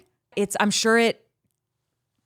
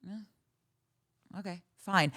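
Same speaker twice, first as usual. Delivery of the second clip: indifferent; 8 dB quieter, much slower than usual. Acted. Viewer bored by overly sweet picks, indifferent. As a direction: let it out softly, unimpressed. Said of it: "I just don't feel anything".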